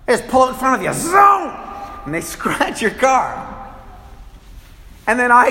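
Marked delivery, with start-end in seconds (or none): 0.9-1.5 shouted high zoom sound effect; mock car zooming; car zoom noise. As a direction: make the car whoosh past, loud and high. Car pulling out.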